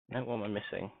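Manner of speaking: ordinary talk, nothing marked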